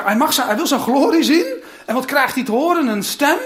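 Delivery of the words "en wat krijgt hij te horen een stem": ordinary delivery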